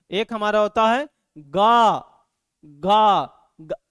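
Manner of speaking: गा loud and slow from the throat; sound demo. Shown as a demonstration. Teacher demonstrating गा from the throat, loud and slow. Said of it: "यहाँ पे हाथ रखिएगा".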